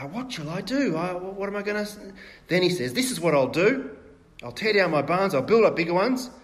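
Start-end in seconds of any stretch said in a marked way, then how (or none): 0.1-2.0 1 rich man worrying. Acted. Rich man's anxious inner dialogue. 2.9-6.3 louder and higher, a self-satisfied rich man; a rich farmer boasting about his bigger barns; rich man planning. Acted.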